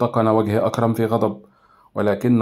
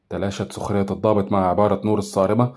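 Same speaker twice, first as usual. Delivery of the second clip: ordinary delivery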